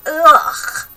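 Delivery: put-on high, loud childish ugh of disgust; boy's disgusted groan. A narrator's reading. George disgusted at caterpillars.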